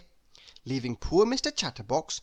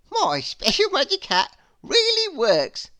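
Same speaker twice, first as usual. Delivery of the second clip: high, louder, gleeful chuckling voice; chuckling hatter; 12 semitones higher, 8 dB louder than usual. Acted. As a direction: gloat with a chuckle over the trick. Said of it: "he chuckled".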